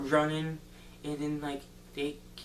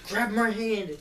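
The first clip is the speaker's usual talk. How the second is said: high, loud acted-out rescue cry; urgent shout; 7 semitones higher, 6 dB louder than usual. Boy to girl hanging from a branch, urgent shout. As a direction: shout as if someone is slipping away.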